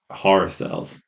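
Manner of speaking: ordinary talk, nothing marked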